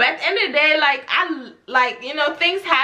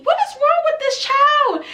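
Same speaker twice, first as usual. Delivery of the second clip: squeaky, much higher exasperated cry; exasperated disbelief; 3 dB louder than usual. Real, not acted. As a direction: throw your hands up in shrill disbelief. Outraged at the lying girl.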